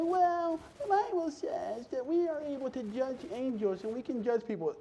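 high, put-on protesting voice; mock objector. Churchgoer justifying judging people, high mocking voice. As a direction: parody a self-justifying churchgoer.

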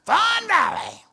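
very high, loud mock cheer; enthusiastic church members. Pastor mimicking his gushing church members, overexcited. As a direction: whoop it out like a crowd of fans.

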